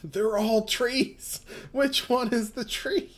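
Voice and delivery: high, slow, baffled voice; confused character. Acted. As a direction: play it lost and bewildered.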